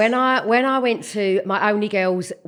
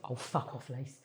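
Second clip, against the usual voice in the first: much lower, softer brush-off; dismissive; 11 semitones lower, 20 dB quieter than usual. Retold or imitated. Friend Jane brushes off sick woman, low and dismissive. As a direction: wave her away, unimpressed. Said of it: "she went like this".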